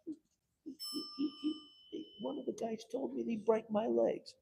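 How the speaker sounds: high, stammering fear; frightened resident. Retold or imitated. Resident telling councilman about a threat, scared stammer.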